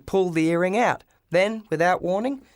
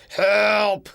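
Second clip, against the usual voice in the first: louder cry from inside a junk pile; muffled call for help; 4 semitones higher, 5 dB louder than usual. Acted. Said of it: "came a deep voice from inside".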